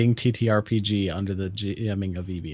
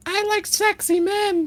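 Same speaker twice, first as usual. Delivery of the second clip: squeaky character voice. Acted. Joking character voice intro.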